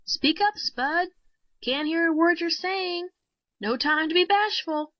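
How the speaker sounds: impatient stage prompting; urging shout. A narrator's reading. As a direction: prod the shy dummy out loud. Motioned him to speak up.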